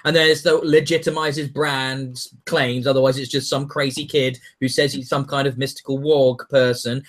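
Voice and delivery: ordinary delivery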